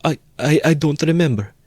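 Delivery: put-on a frightened old mother stumbling; faltering. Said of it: "faltered Pulcheria Alexandrovna".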